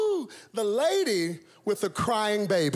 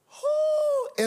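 exclamation. Acted. Dreaded middle seat.

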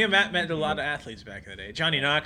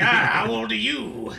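higher, slow, laughing growl; gung-ho coach voice; 6 semitones higher, 4 dB louder, slower than usual. Retold or imitated. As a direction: drawl it like a hammy colonel. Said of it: "He's like".

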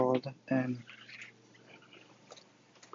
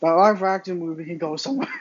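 usual speaking voice

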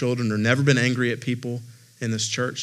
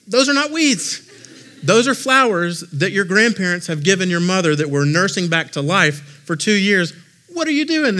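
mock scolding; 6 semitones higher, 4 dB louder than usual. Dad scolds son over flowers.

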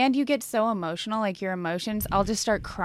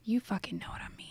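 whisper; 11 dB quieter, faster than usual. Real, not acted. Laughing after tongue slip.

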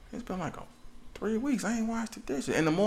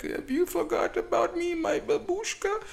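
put-on high, slow old babushka voice; pleading old Russian granny, high and slow. Old Russian charity figure voiced.

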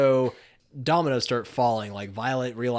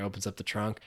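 usual speaking voice

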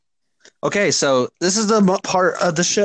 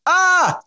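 saying ahh; 12 semitones higher than usual. Shown as a demonstration. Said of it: "you guys ready?".

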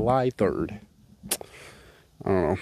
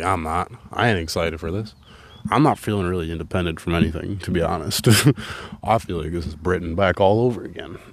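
ordinary talk

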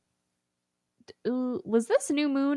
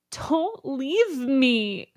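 high, drawn-out mock plea; hammed-up desperate begging, very slow; pleading Bella; 5 semitones higher, 3 dB louder, much slower than usual. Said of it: "Bella's like".

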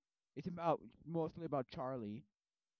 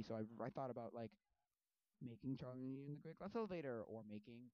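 normal talking voice

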